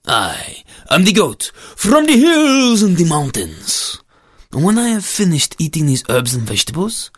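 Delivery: boastful goat with stretched, swooping vowels; menacing storybook goat. Acted. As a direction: draw out the grand threat as a goat.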